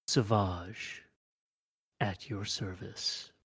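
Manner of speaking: low, soft, slow creature bow. Acted. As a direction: murmur the name with a bow. Creature gives its name.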